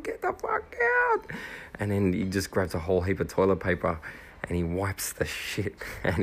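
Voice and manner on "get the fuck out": very high, squeaky mock panic; mock panicked yell. Friend caught out, shooing teammates away in a high squeal. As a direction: shoo them off in a squeal.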